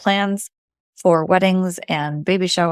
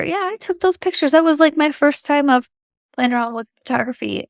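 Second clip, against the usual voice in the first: higher, lightly voiced proud girl; proud kid voice; 7 semitones higher than usual. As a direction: play the proud daughter. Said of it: "She can tell her little sister".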